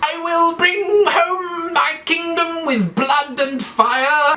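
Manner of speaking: very high, slow falsetto vow; hammed-up queen squealing a war cry; Daenerys Targaryen. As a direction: stretch each word out in falsetto. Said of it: "That's exactly what she sounds like".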